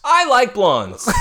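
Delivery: high, loud, slow blurting; mock confession. Joking as Clark dumping Lana, hammed-up confession.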